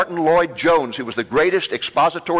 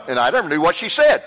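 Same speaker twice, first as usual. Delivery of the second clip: ordinary delivery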